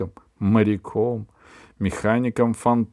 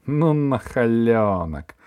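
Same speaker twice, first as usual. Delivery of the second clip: drawn-out gleeful tut, slow; gleeful scolding; 2 semitones higher, 4 dB louder, much slower than usual. A narrator's reading. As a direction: savour the word like a victory. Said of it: "радостно говорила тётя Валя".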